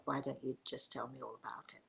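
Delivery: very soft, low, near-whispered coaxing; hushed invitation. Retold or imitated. Speaker inviting the upset stranger to talk, hushed. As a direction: drop right down to a gentle, confiding hush.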